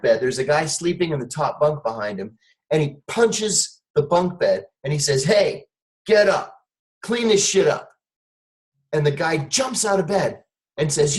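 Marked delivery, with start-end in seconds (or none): 5.2-7.9 raised, higher-pitched retold command; mildly barked wake-up order. Boss ordering a sleeping underling to clean up, retold bark.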